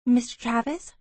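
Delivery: mildly crisp question. A narrator's reading. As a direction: ask it briskly, lifting at the end. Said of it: "I asked crisply".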